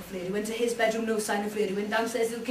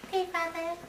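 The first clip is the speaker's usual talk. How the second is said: higher small reply; meek son voice; 6 semitones higher, 3 dB quieter than usual. Acted. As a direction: answer like a timid child. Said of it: "said William".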